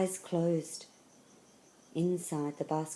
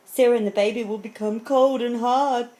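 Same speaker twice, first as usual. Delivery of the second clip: higher, loud, urgent storybook thought; alarmed lion; 6 semitones higher, 8 dB louder than usual. A narrator's reading. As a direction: worry aloud as the lion. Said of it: "he thought".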